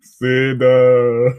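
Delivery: drawn-out creature moan; mock pollen voice. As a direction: moan the name like a blob monster. Imitating pollen's voice.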